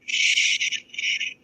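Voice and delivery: a breathy whispered "Shh"; hushing. Acted. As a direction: silence them with a hush. Hushing a date in a scene.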